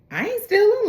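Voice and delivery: indignant denial. Retold or imitated. Brother accused of stealing money, retold indignant protest. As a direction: imitate a protesting high-pitched denial. JB denies theft when confronted.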